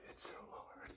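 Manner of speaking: strained whisper. Real, not acted. Whispered repeat.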